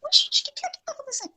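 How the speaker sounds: high, hushed bafflement; soft, high-pitched wondering voice; puzzled amazement. Retold or imitated. People baffled by the client's new look, amazed.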